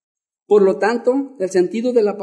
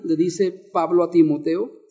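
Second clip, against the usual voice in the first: ordinary delivery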